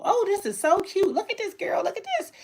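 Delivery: high-pitched gushing over something cute; imitated gushing shoppers. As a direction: squeal to a friend like an admiring customer. Imitating admiring shoppers at her stall.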